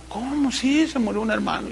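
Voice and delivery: a son recalling quiet talk with his mother; hushed retelling. Retold or imitated. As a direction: drop to a near whisper recalling it.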